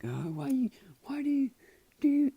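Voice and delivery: stammering acted frustration; exasperated demand. Upset girl questioning the boy, high exasperated retelling. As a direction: climb to a high, loud mock outburst.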